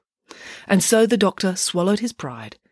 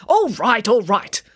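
louder, higher giving-in; exasperated concession; 6 semitones higher, 5 dB louder, slower than usual. A narrator's reading. Nanny caught lying gives in, exasperated. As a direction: give in, exasperated.

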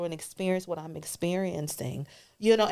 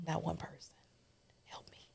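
hushed whisper; 12 dB quieter than usual. Real, not acted. Guest recalling being alone, in a hushed whisper. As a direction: whisper it like a painful confession.

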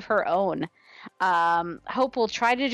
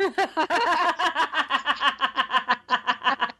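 laughing; 4 semitones higher than usual. Real, not acted. Long laugh at the joke.

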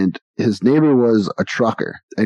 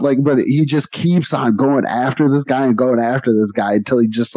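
normal talking voice

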